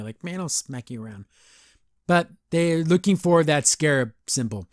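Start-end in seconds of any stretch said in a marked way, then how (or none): 0.2-1.3 imitating a mean threat, low-key and soft; Tigress. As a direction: toss off a mock bullying threat under your breath. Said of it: "she's just being super mean".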